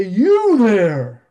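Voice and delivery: loud booming call from the pulpit; the late chiropractor preacher pointing at a listener, booming. Retold or imitated. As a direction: boom it out at the congregation. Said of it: "He had a booming, deep voice".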